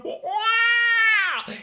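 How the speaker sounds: a loud, high cartoon yelp. Narrator as Peter sent flying, loud high yelp. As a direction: shriek it as you fly across the room. Boy blasted by hose.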